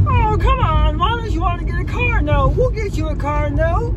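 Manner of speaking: high mock car-dealer pitch; squeaky, coaxing salesman impression; pushy salesman. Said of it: "has just been like".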